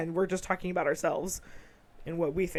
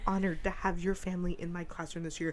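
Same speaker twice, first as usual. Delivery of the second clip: ordinary talk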